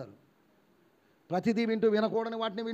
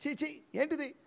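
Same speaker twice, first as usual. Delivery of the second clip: high, softer scoff of disgust; acted disgust; 7 semitones higher, 4 dB quieter than usual. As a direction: recoil in mock disgust as the apostle. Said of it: "అసహ్యించుకుంటున్నాడు Paul".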